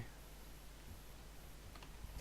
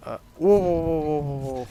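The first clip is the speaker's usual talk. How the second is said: здивований вигук; 2 semitones higher, 7 dB louder than usual. Real, not acted. Здивований погляд на хлопця.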